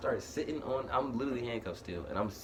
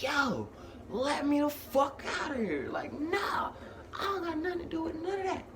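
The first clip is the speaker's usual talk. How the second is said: very high mock scream, not full volume; retold screaming. Detainee demanding release through the speaker, strained mock scream. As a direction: wail it high like an imitated scream. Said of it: "I'm screaming in that bitch".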